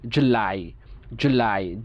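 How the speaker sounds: July, with the লাই pressed harder and the জু held long; July বলুন, লাই-তে একটু চাপ দিয়ে; stress on lai. Shown as a demonstration. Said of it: "লাই একটু চাপ দিয়ে". (লাই-তে চাপ দিয়ে জুলাই)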